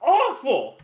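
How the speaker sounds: high, slow indignant whine; indignant imp. Acted. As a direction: protest like an offended imp. Chard protesting theatrics.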